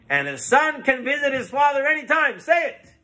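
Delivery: high, emphatic demand; insistent command. Acted. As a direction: press him hard to say it. Rabbi insistently orders son-in-law to answer.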